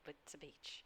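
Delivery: whispered throwaway aside; under breath. Real, not acted.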